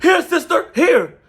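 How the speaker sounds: high, eager voice of a sucking-up helper; mocking Biggie. Mocking Biggie fetching water.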